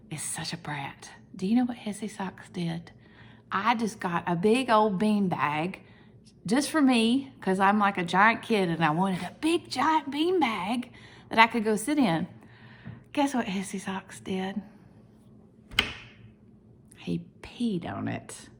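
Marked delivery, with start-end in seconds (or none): 0.1-2.9 softer, hushed gossip about the cat; whisper. Real, not acted. 13.1-18.5 whisper. Real, not acted. Whispered cat gossip.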